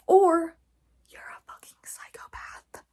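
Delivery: sharp whispered aside. Real, not acted. Calling uncaring people psychopaths, whispered. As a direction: hiss the insult under your breath.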